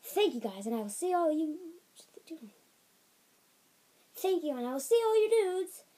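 hyped YouTuber outro with bursts of yelling; Jacksepticeye. Retold or imitated. Kid copying a YouTuber's sign-off, hammed-up impression. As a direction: punch out key words, high and loud. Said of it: "I'm Jacksepticeye".

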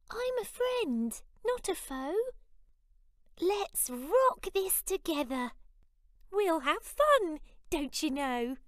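very high squeaky friendly voice; a tiny buddy cheering on a big pal; cheerful mouse. Acted. Mouse inviting the lion to have fun, squeaky and cheerful.

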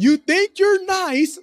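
high, loud, drawn-out mock shout; playful shout. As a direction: shout it out long and high as a family catchphrase. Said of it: "we shout out".

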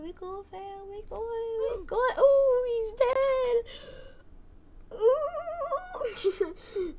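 high, rising nervous squeal; scared noises. Real, not acted. Player panicking over the game, scared high-pitched noises. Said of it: "I make the weirdest noises when I'm scared".

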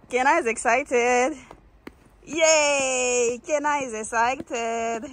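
slow, drawn-out singsong cheer; excited singsong. Real, not acted. Vlogger cheers that Kenna is excited, in slow singsong. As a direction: stretch it out like a happy little chant.

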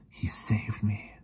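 slow, hushed whisper; soft, slow, shaken whisper. A narrator's reading. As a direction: whisper it, remembering the terror. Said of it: "she whispered".

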